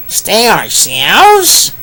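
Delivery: high, very slow puzzled pig; slow high piglet wondering. Acted. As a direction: drag out the confused question. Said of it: "asked another pig".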